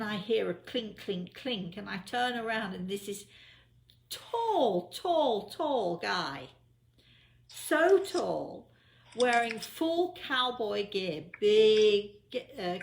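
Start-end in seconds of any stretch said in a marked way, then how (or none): 4.1-8.7 each tall pitched much higher and louder; emphatic tall. Real, not acted. Telling friends how huge the cowboy was, emphatic. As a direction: stretch the word tall up high to show his height.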